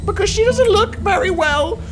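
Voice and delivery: very high put-on little kid voice; high-pitched mock child asking a worried question; imitated child. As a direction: squeak it like a child asking mommy. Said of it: "He's like".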